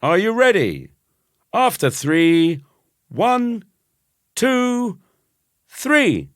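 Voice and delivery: high, loud countdown call; bellowing the race countdown from the top of the steps; top of his voice. Acted. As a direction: shout the count to start the race. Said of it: "At the top of my voice".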